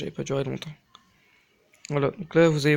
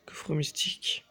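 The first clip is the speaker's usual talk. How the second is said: softly repeated "Coffre mystique", half whispered; hushed echo; 4 dB quieter than usual. Real, not acted. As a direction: say it under your breath, lingering. Repeats chest name more softly. (à mi-voix, presque chuchoté)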